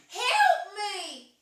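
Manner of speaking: imitated distant victim's loud wail; high, loud hammed-up plea; cry for help. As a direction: yell it out, drawing out the plea. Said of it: "So I hear the cry again".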